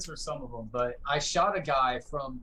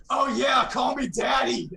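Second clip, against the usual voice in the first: much higher and louder smug shout; cocky taunt; 10 semitones higher, 7 dB louder than usual. Retold or imitated. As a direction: gloat over the body. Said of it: "and he went".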